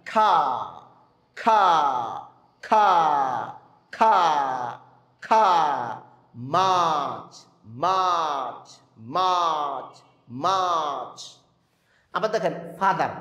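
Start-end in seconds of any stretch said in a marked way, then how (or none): 0.1-5.5 খা repeated slowly with pauses, mouth open; drilled খা. Shown as a demonstration. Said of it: "এইভাবে মস্ক করাবেন". 6.6-11.2 মাছ stretched long with a falling tune; long drawn-out মাছ. Shown as a demonstration. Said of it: "এইভাবে মস্ক করাবেন".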